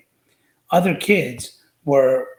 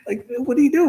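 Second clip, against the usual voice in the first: baffled question; 12 semitones higher, 3 dB louder than usual. Retold or imitated. Baffled retort, retold.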